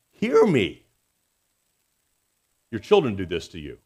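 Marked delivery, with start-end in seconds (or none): 0.1-0.8 loud, high demand to be heard; bold plea. Retold or imitated. David boldly pleads to God, bold and loud. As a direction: call out boldly, pitch jumping up then falling. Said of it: "boldly and says".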